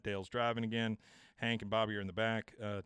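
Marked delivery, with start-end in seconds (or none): none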